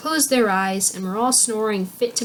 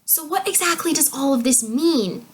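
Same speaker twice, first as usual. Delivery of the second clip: higher, mildly impatient question; a touch of impatience, pitched up; 6 semitones higher than usual. A narrator's reading. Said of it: "cried Igraine impatiently".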